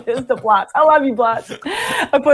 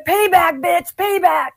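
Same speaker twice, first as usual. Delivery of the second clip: much higher mimicked taunt; imitated taunt; 9 semitones higher than usual. Storyteller mimics the pranked drummer promising revenge, high taunting voice. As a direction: crow it like a retold threat. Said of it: "And he's like".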